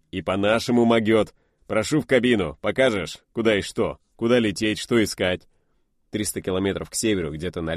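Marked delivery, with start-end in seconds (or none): 0.1-5.5 hearty loud pilot. Acted. Pilot welcoming Ershov into the cockpit, loud and cheerful. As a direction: welcome him aboard with rough cheer. Excited pilot over engine.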